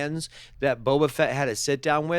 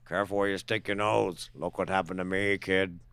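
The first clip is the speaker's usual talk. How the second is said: lower, softer voice of one who learned the hard way; grizzled cautionary veteran; 5 semitones lower, 4 dB quieter than usual. Acted. Noseless character warning a kid, grizzled veteran voice. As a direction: warn the kid from bitter experience. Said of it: "he's speaking from experience".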